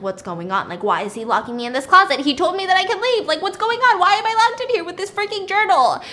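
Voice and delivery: building to high, loud panic; hammed-up mounting hysteria; imitated freaking out. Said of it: "And she starts freaking out".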